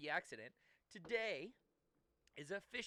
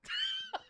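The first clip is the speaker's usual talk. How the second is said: shrieking laugh; 6 dB louder than usual. Real, not acted. Bursts out laughing at accent.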